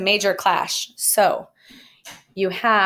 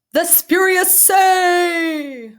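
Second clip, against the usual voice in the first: much higher and louder, slow and drawn out; grand announcement; 11 semitones higher, 6 dB louder, much slower than usual. Real, not acted. Teacher unveiling her favorite grammar term, grand and drawn out. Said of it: "my favorite name for any grammatical term".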